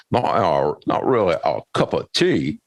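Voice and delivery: second try at a British accent, hammed-up. Shown as a demonstration. Host retries a British accent for his cohost, mock posh. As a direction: put on a posh British voice, half-joking. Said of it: "Let me try that".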